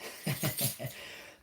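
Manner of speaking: amused, high airy chuckle; real laugh. Real, not acted. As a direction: laugh after your own joke. Laughs after joking about the dolls.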